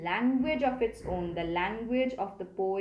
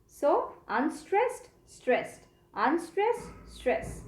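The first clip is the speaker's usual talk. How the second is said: "unstressed" lifted higher and slow, "stressed" level; scanning the beats; 5 semitones higher, slower than usual. Shown as a demonstration. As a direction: call out the beats slowly like a metronome. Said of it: "we can see that loving in truth".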